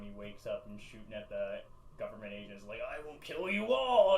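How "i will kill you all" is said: booming furious shout, high and loud; angry robot shout. Retold or imitated. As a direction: roar it like an enraged robot leader. Optimus Prime quote, angry robot.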